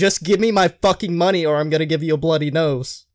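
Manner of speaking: hammed-up furious demand for money; mock angry threat. Retold customer threat.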